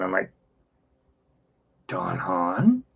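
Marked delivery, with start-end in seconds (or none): 1.9-2.8 puzzled surprise. Retold or imitated. Retells surprise at familiar name.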